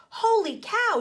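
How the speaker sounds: high, loud surprised yell; girl shocked by the flood. Acted. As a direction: shout it in shock. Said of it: "she yelled".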